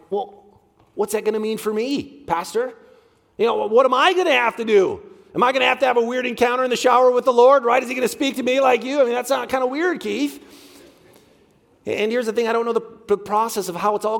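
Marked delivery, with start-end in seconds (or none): 0.1-10.4 hammed-up worried churchgoer, higher and louder; mock the skeptic's anxious questions; doubting listener. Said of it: "You're like".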